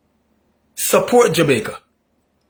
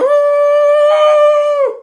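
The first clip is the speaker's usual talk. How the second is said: high, loud imitated scream; mock scream; 6 dB louder than usual. Mocking the screaming opposition, a high loud scream. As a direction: mimic a politician screaming his head off.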